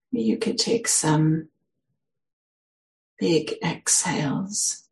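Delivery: unhurried guidance with long pauses; guided meditation. Acted. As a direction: ease listeners into stillness, phrase by phrase.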